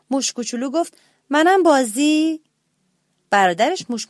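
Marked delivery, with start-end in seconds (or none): 1.3-2.4 timid plea. A narrator's reading. Little mouse asks to join in.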